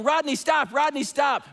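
high, repeated mock yell. Mom calling her boy back from the edge, yelling. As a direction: imitate a mother's repeated high-pitched shout. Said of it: "as she's yelling".

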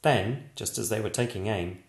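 usual speaking voice

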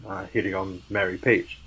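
ordinary talk

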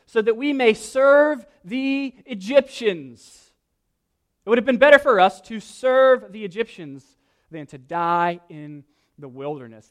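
loud, high mocking lament; mock their longing for slavery; sarcastic complaint.

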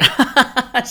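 loud high burst of laughter; sudden high loud laugh. Real, not acted. Laughs at Dorit joke.

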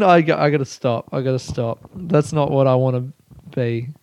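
ordinary delivery

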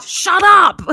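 screams it in disbelief; a delighted shocked yell, high and loud; shocked shout. Real, not acted. Listener shocked at the story.

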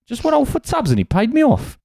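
imperfect, high-pitched Geordie; Geordie accent. Acted. Topping a friend's Geordie Shore impression, in an imperfect Geordie. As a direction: put on a jovial Geordie accent. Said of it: "my Geordie's not fantastic".

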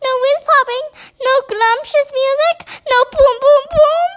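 high, incredulous questioning; squeaky, aghast giant voice; dismayed cry. A narrator's reading. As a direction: list each loss in high-pitched disbelief. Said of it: "cried the B F G".

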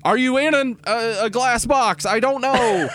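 high-pitched, pleading frustration; exasperated caller. Acted. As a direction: question a silent mime in a high, exasperated voice. Acting exasperated caller talking to mime.

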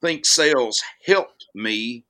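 ordinary talk

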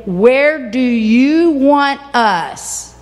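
"Where do you want us" stretched very slowly; drawn-out emphasis. Acted. Disciples asking Jesus for orders, "Where do you want us" slowly stretched. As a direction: draw out each word of "Where do you want us".

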